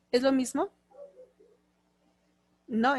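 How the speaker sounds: ordinary delivery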